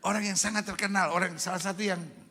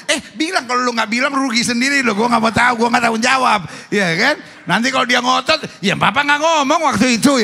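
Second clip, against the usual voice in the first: raised voice, playful warning; loud urging; 5 semitones higher, 12 dB louder than usual. Real, not acted. Preacher urging a husband to speak while she is happy, loud urging. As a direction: push him loudly to say it now.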